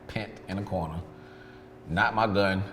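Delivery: ordinary delivery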